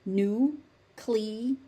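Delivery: "Nu-cle-er" broken into three slow, separate beats; syllable-by-syllable demo. Shown as a demonstration. Teacher showing learners the accepted word, syllable by syllable. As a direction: say "Nu-cle-er" one syllable at a time. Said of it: "learn nuclear".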